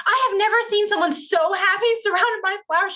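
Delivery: high, gushing delight; delighted stranger. Retold or imitated. Artist gushing to Cindy about the flowers, high. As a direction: artist charmed by her joy, high and gushing.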